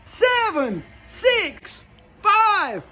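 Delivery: cheering crowd counting down; loud crowd yelling the countdown; new year countdown crowd. Real, not acted.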